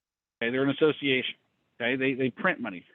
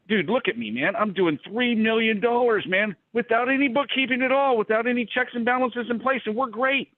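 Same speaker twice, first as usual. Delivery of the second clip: higher, cocky mock of a bragging owner; smug owner; 7 semitones higher than usual. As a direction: brag like you've got it all figured out. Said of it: "take everything ... and go".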